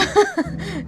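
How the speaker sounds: short, high chuckle; amused laugh. Real, not acted. Co-host admitting they avoided explaining, amused laugh. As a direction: laugh it off lightly.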